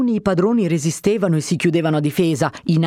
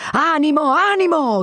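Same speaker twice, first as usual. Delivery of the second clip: rally the men; shouting captain; 10 semitones higher than usual. A narrator's reading. Captain urging his guards on, shouting. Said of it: "gridava il capitano".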